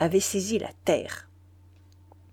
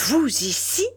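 sharp, higher outcry of shock; startled cry; 5 semitones higher, 3 dB louder than usual. A narrator's reading. The general, seeing the murderer appear, cries out in shock. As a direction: cry out, caught off guard. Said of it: "s'écria le général".

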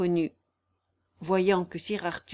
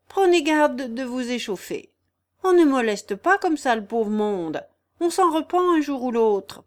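lightly voiced old vagabond, raised and louder; admonishing vagabond; 5 semitones higher, 7 dB louder than usual. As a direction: warn off an attacker in mild character. Raguet warns his attacker.

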